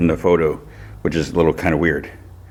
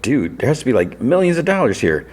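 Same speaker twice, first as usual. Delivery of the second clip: high-pitched imitation of disbelief; retold astonishment, lifted well above the usual voice; mock amazement; 7 semitones higher than usual. Friend seeing crates of money, amazed imitation.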